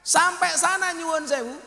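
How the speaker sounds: loud, high call to the crowd; shouted. Real, not acted. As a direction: proclaim it loudly. Preacher shouting the story. (teriak lantang)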